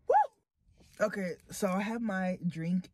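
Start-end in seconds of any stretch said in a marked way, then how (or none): 0.1-1.1 high loud whoop; loud woo. Real, not acted. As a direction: whoop through the disgust. Reacting to awful taste.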